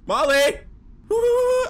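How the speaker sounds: loud, high call. Acted. Calling for the lost dog Molly in the dark, loud.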